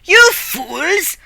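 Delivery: high mock scold; scolding hero. Hero scolds the screaming cats, high mock scolding. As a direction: bark it at the silly cats.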